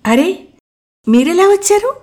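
delighted. A narrator's reading. Girl greets Mukul at the station, delighted. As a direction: greet him with delighted surprise. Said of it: "అన్నాను సంబరంగా".

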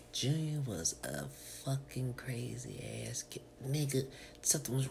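hushed gossiping whisper. Real, not acted. Whispering to the crew about a crazy kid. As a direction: lean in and whisper the dirt.

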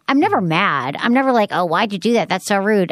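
ordinary talk

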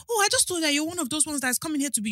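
high-pitched imitation of an excuse; staff woman backpedalling.

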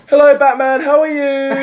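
high, loud, laughing mock of his mum; mum's greeting imitated. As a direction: chirp it out like an overly cheerful mum. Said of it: "She went".

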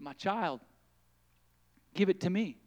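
quiet loving voice of the Lord; the Lord, gentle and hushed. Acted. The Lord comforting the weary mother, softly.

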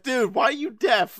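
high, drawn-out complaint breaking into laughter; bugged, mock complaint. Friend retelling his annoyance at one-sided deafness, mock exasperated. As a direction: whine it slowly in mock exasperation. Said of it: "it bugged me. I'm like".

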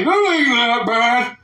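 put-on slow, loud, drawn-out old man reassurance; rambling old politician. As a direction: play a doddering elder off on a tangent. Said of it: "always talking about his goddamn father".

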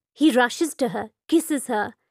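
ordinary delivery

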